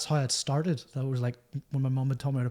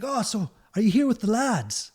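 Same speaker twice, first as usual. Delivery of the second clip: high put-on Irish accent; Tommy Tiernan; 6 semitones higher than usual. Comedian asking musician about bandmates, imitated high Irish accent. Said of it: "sorry for the terrible accent".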